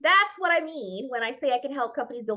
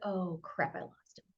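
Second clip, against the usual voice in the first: dismayed mutter; 5 semitones lower, 11 dB quieter than usual. Retold or imitated. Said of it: "you're like".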